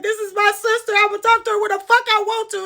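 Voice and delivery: high-pitched, indignant clapback; defiant retort. Retold or imitated. Sister talking back to her aunt, high and defiant.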